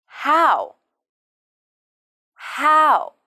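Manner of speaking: "How?" said alone, drawn out, falling; word drilled alone. Shown as a demonstration. Teacher models one word for learners, slow drill. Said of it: "How many children".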